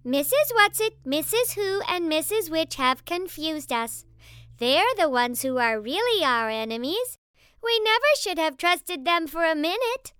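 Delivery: loud, high, infuriatingly reasonable explaining; insistently reasonable. A narrator's reading. Possessed boy explains who the enemies are, insistently reasonable. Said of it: "He spoke in his calmest, most reasonable voice".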